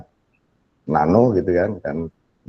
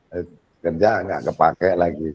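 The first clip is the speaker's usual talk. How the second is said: ordinary talk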